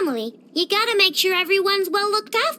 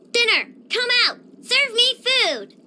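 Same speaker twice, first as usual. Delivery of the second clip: commanding call; 5 semitones higher than usual. Acted. Ordering her walking plates.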